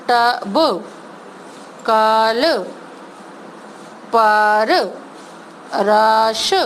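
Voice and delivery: put-on slow, clear word-by-word reading for children; reading words aloud. Shown as a demonstration. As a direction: read each word out slowly like a teacher. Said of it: "जब किसी word पे मात्रा नहीं होती है तो हम उसका साउंड स्लो करते हैं". (हर शब्द धीरे-धीरे साफ़ बोलना)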